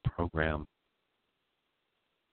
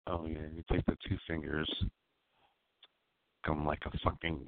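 normal talking voice

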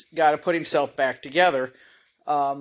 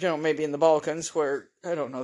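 usual speaking voice

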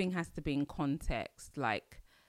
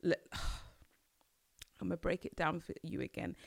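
usual speaking voice

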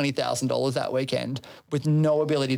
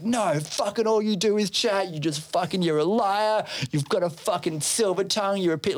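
higher-pitched, indignant neighbour impression; imitated angry tirade; 6 semitones higher than usual. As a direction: act out a neighbour hurling accusations. Retold neighbour's tirade in a quarrel.

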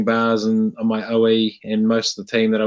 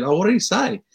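high curious question; 10 semitones higher than usual. Retold or imitated. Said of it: "I said".

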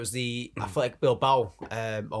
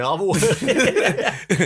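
very slow, high, louder, laughing; laughing mock reassurance; 7 semitones higher, 6 dB louder, much slower than usual. Mock priest promising favours with God, very slow laughing voice. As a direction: drawl a smug promise through laughter.

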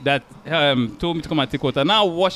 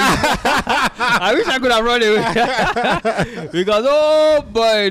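laughing; 7 semitones higher, 4 dB louder, much slower than usual. Real, not acted. Laughing about school bullying.

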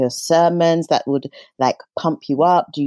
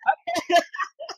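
high laugh; 5 dB quieter than usual. Real, not acted. Laughs, moved by the story.

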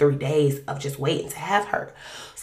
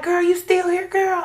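put-on high, loud girl you still here; a friendly nurse teasing; surprised nurse; 12 semitones higher, 5 dB louder than usual. Nurse surprised the patient is still there, high and loud.

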